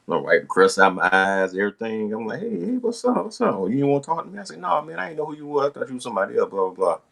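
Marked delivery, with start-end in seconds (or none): 2.4-7.0 eager phone chat. Retold or imitated.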